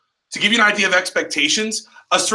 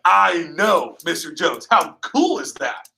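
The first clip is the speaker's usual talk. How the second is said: slow, drawn-out enthusiasm; ecstatic; slower than usual. Shown as a demonstration. Trainer showing the ideal reaction to a customer, ecstatic. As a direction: share the customer's amazement with gusto.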